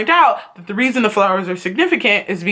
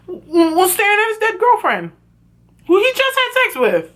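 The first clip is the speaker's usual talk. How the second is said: high, stammering incredulous squeal; mock wailing disbelief; 10 semitones higher than usual. Real, not acted. Fan describes Noah over his dead girlfriend, high incredulous squeal. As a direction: climb higher in disbelief.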